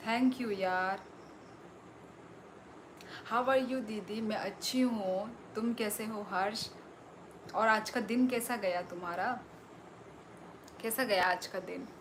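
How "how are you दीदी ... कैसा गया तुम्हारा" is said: usual speaking voice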